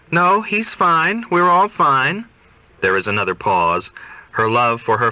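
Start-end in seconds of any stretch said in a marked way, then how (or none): 0.1-2.2 high, bright, a touch too cheerful; forced brightness. Acted. Daughter hiding trouble from her father, high forced cheer. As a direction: cover up with sunny reassurance.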